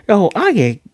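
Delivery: light kid-voice imitation; Anya voice. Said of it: "And Anya's like".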